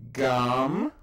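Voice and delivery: Gam said loud and clear, slow; Hebrew reading demo. Shown as a demonstration. Teacher models Gam from the chart, loud and deliberate. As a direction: model the word Gam for the class. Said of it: "reads chart word".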